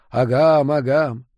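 imitated echo, second one fainter. A narrator's reading. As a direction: let the repeat fade like an echo. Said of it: "голос разлетелся эхом". (затихающее эхо)

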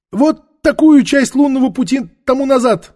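very high, loud crone voice; frightened old woman. Acted. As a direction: answer anxiously in a high whine. Old woman answers in fear.

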